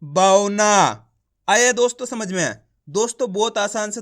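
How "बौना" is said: बौना drawn out very slowly, bau-na; slow blended word. Shown as a demonstration. Teacher blends the letters into बौना. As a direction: stretch बौना slowly and loudly. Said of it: "तो मिलके बन जाएगा".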